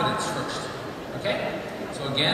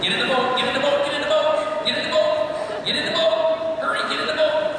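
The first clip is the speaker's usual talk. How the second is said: rider urges student onto safety, hurried and raised; urgent coaching call; 9 semitones higher than usual. Real, not acted. Urgent call as horse spooks.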